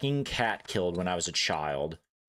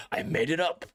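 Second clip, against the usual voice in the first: laughing confession; 7 semitones higher than usual. Real, not acted. Repeats admission laughing.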